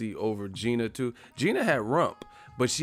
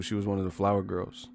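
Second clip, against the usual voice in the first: normal talking voice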